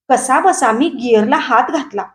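usual speaking voice

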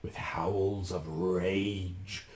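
very slow, whispery narration; drawn-out, hushed. A narrator's reading. Narrator describes magicians' rage, slow and whispery. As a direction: stretch each word out, low and airy.

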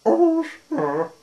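very high, loud little squeak; sad, squeaky voice. A narrator's reading. Unseen figure answers a knock at the door, sad squeak. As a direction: squeak it out pitifully. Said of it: "little sad and a little squeaky sort of voice".

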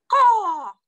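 loud, high-pitched crow caw; piercing cartoon crow cry; crow's caw. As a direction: call out like a crow. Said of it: "Crow, who was clever and crafty".

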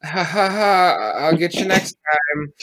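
forced, loud awkward laughter into a brush-off; hammed-up awkward chuckle; awkward fake laugh. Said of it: "I was like".

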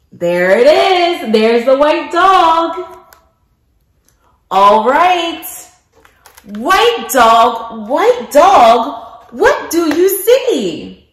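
lilting picture-book narration with big questions; reading to children. Acted. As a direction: read it like circle time for little kids.

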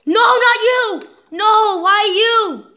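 high, loud, slow wail; drawn-out despairing yell; anguished cry. Real, not acted. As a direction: cry out at the loss. Teammate dying.

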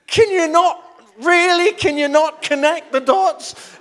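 loud, high, exasperated disbelief; can't believe what they're trying, said loud; incredulous outburst. Retold or imitated. Exasperated at sofa-carrying strangers, loud incredulous disbelief. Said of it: "I'm looking at them going".

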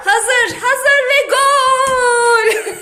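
drawn-out, high, laughing goal call; screaming football commentator. Pretend. Calling the goal.

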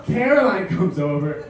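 ordinary talk

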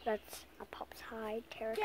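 whispered aside; whispering. Real, not acted. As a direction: breathe it quietly.

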